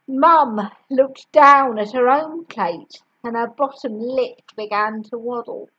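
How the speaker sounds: plain reading voice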